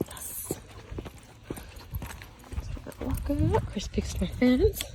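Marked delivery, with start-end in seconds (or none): none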